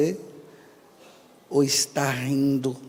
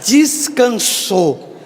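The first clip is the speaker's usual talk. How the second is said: drawn-out mock consolation; 6 semitones higher, 6 dB louder, much slower than usual. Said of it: "o arremate final da conversa é essa".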